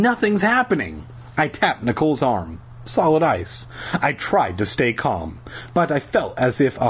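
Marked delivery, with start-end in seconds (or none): 0.0-1.1 high-pitched, whiny despair; groaning. A narrator's reading. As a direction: moan it, pitch raised. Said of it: "Lauren groaned".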